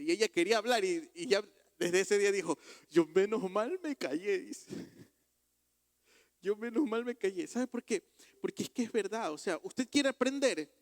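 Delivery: loud, fast preacher's push; urgent sermon drive; full-force preaching. Acted. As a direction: press the lesson on the listeners. Preaching the lesson.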